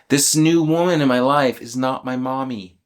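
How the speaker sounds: ordinary delivery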